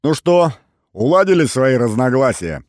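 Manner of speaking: loud, high cheerful taunt; cheerful question. A narrator's reading. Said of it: "весело спросил я". (весёлый громкий вопрос)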